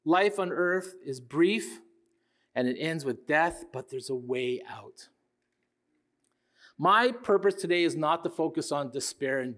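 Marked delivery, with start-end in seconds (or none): 3.7-5.1 breathy whispered hint, slow and soft. Acted. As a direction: lean in and whisper the hope. Hushed promise of escape.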